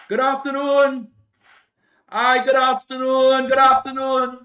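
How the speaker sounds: loud, high greeting called toward the house; a polite visitor calling at the gate. Retold or imitated. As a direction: call it out so someone inside hears.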